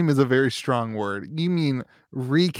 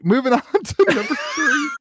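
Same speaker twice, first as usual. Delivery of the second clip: laughing; 11 semitones higher, 3 dB louder than usual. Real, not acted. Laughing through transition.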